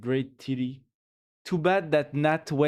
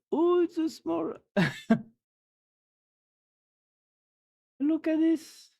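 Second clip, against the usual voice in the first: cooing over cuteness; 14 semitones higher than usual. Real, not acted. Cooing at cute animal photo.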